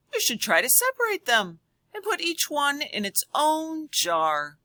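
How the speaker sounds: put-on high, loud girl's voice; caring girl character. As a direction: coax the little monster like a kind girl. Little girl speaks to monster.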